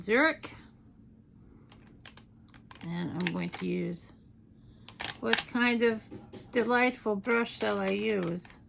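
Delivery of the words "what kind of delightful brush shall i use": ordinary delivery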